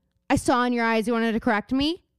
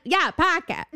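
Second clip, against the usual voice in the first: imitated Boston accent; 5 semitones higher, slower than usual. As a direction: confirm it emphatically in a Boston accent. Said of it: "story about Boston accent".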